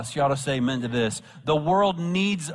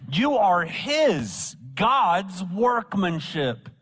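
high, drawn-out proclamation; emphatic preaching; 5 semitones higher, slower than usual. Real, not acted. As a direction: proclaim it slowly, swooping up on key words. Preacher driving home the verse.

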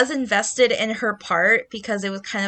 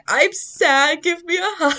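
whiny sad plea; 9 semitones higher, 3 dB louder than usual. Retold or imitated. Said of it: "busting out crying".

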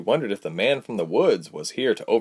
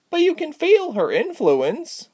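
much higher, slow, earnest insistence; stressed; slower than usual. A narrator's reading. Pia insisting to her husband, high and slow. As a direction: press the point hard. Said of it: "Pia stressed".

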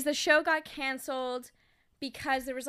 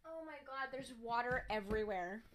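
nose hurts; 10 dB quieter than usual. Real, not acted. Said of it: "My nose really hurts".

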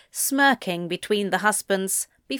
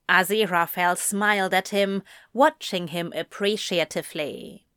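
plain reading voice

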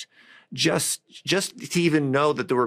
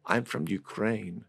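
put-on a soft, slow thick Ukrainian accent; 6 dB quieter, slower than usual. Tearful Ukrainian server answers the diner, in a soft thick Ukrainian accent. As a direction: say it quietly and slowly in a heavy Ukrainian accent. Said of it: "this very thick accent".

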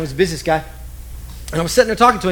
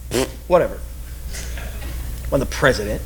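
low, scoffing disbelief; lower, dismissive scoff; 9 semitones lower, 6 dB quieter, faster than usual. Retold or imitated. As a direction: brush it off with a low pfft.

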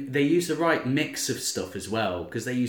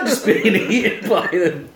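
usual speaking voice

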